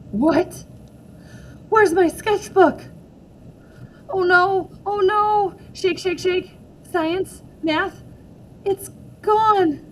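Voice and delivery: high, loud frantic search; rising panic over the lost sketchbook; panicked. Acted. May finds her sketchbook missing.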